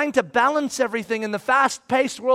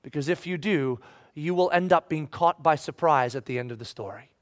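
softer, grave aside; quiet warning; 7 semitones lower, 6 dB quieter than usual. Acted. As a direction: drop low and grave. Sober warning after plea.

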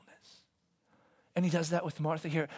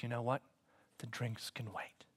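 soft reassuring whisper; 6 semitones lower, 13 dB quieter than usual. Acted. Jesus gently reassures Martha.

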